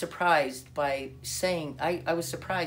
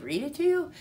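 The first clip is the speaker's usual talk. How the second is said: incredulous echo; 12 semitones higher, 5 dB louder than usual. Retold or imitated. Asked to read the Bible aloud, incredulous echo. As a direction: voice leaping high and louder on the question.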